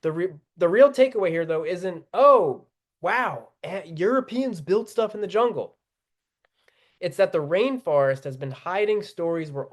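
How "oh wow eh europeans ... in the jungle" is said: hammed-up "oh" starting high, then easing; mock amazement. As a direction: imitate someone naively impressed, tongue in cheek. Mocks a naive amazed reaction.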